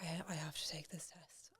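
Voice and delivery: a hushed, frightened whisper; scared whisper. Retold or imitated. Teen girl dreading the pregnancy test, frightened whisper. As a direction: breathe it out, barely voiced, scared.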